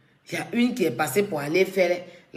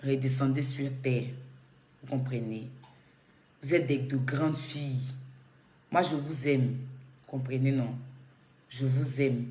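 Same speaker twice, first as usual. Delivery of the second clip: quieter and lower, calm and sincere; serious, soft advice; 6 semitones lower, 5 dB quieter than usual. Real, not acted. Scolding two grown women gently, calm and quiet. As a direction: soften and plead gently with both women. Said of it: "pour être plus sérieuse".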